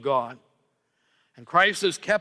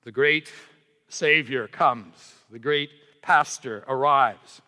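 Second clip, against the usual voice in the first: voicing the gloomy church with a weary sag; mock defeatist sigh; 2 semitones lower than usual. Preacher mimics pessimistic churchgoers, slow and resigned. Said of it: "and to say".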